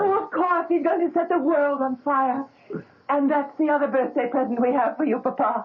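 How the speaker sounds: high, bubbling announcement; can't hold in the news; excited. Acted. Ilse announces more presents.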